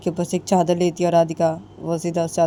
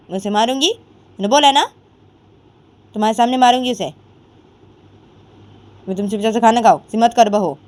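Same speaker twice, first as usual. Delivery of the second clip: loud, raised, stern scolding; scolding mother-in-law; 5 semitones higher, 7 dB louder than usual. Acted. Mother-in-law scolds daughter-in-law to eat, loud and stern. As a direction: bark orders to eat at once.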